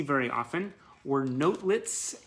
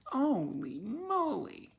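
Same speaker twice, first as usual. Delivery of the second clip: high, slow swooning gush; 10 semitones higher, much slower than usual. Real, not acted. Fan swooning over a smoldering photo, high and drawn out.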